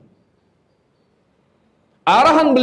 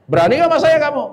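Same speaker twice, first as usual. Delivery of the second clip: challenging neighbour; 7 semitones higher than usual. Retold or imitated. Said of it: "kata dia".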